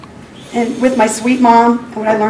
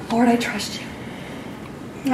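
slow breathy whisper of trust; whispered surrender; 12 dB quieter, much slower than usual. Retold or imitated. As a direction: barely voice it, giving in. Surrendering prayer after God's prompt.

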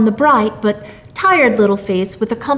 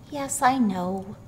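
put-on soft, slow, meek little boy; small, subdued confession; meekly; 8 dB quieter, slower than usual. A narrator's reading. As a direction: admit it softly and slowly. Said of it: "said little Billy meekly".

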